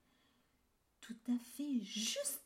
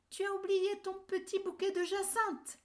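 higher, louder medium bear call; middle bear voice calling; 11 semitones higher, 7 dB louder than usual. A narrator's reading. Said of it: "de sa moyenne voix". (voix moyenne qui appelle)

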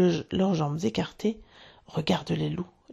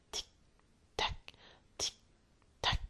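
soft unvoiced tick-tock whisper; faint whispered ticking; ticking clock; 12 dB quieter, much slower than usual. A narrator's reading. Ticking crocodile approaching.

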